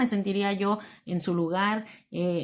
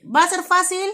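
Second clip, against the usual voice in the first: emphatic question; 9 semitones higher, 7 dB louder than usual. Acted. Rhetorical question to congregation.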